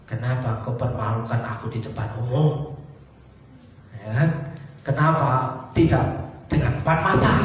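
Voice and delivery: hurt protest from a shamed churchgoer; a sinner, rebuked in public, grumbles wounded and low; offended sinner. Retold or imitated.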